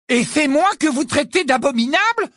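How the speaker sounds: loud, high-pitched outrage; indignant. A narrator's reading. Ron answers the portrait calling him abominable, indignant. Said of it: "s'indigna Ron".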